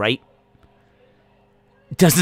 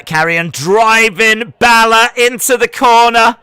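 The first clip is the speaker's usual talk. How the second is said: raised, higher live wrestling call; play-by-play commentary; 9 semitones higher, 7 dB louder than usual. Acted. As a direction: call the action like a ringside commentator. Calls the action again.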